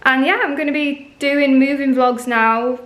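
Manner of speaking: normal talking voice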